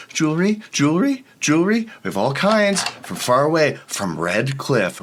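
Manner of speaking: high, sing-song street vendor cry; hawking merchant. Acted.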